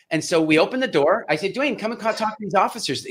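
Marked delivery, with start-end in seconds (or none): 1.5-3.1 put-on higher, gentle voice to coax a kid over; lightly coaxing a shy boy, pitched up; talking to a child. Calling the shy boy over to meet officers, higher and gentle.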